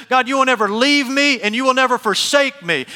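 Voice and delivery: loud, high cry to God; imitated scream. Preacher acting Luther alone in a field, mock scream. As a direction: cry it out like a desperate declaration. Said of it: "he screamed this".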